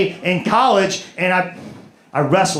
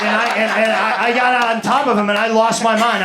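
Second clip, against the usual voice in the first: normal talking voice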